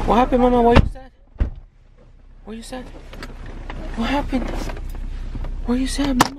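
high, tender voice to an upset little one; concerned coaxing. Real, not acted. Parent asks upset child what happened, tender high coaxing. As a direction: gently coax out what's wrong, pitched high.

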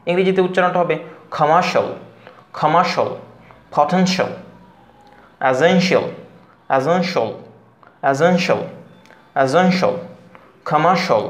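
কমার্শিয়াল said as komarshal, no শিয়াল; এসেনশিয়াল ending in a short shal sound; shal not shiyal. Shown as a demonstration. Teacher demonstrating the English -tial ending. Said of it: "এগুলো আসলে শিয়াল উচ্চারণ নাই".

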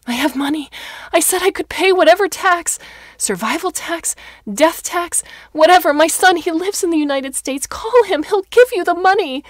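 high, loud desperate begging; old woman pleading with attendants not to take her; frantic pleading. Acted. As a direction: beg wildly for your life.

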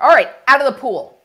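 loud exasperated order; losing your cool. Shown as a demonstration. Parent losing patience with kids at the pool, loud. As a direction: snap it out, fed up.

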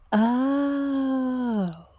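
long drawn-out rising-falling oh; a lightbulb-moment oh; dawning realisation. Acted. Sammy as the light bulb goes on, dawning realisation. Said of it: "And he goes".

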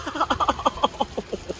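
short breathy laugh through the hurt; pained laugh. Real, not acted. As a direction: laugh it off, still wincing. Laughs off the pain.

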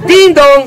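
station chime. Acted.

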